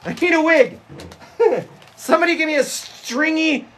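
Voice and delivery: high, insistent call across the house. Real, not acted. Calling Bailey to bring a crimper for comedy, high pleading.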